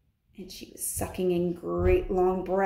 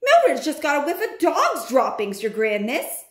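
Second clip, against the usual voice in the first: witch calling back; 5 semitones higher, 4 dB louder than usual. Acted.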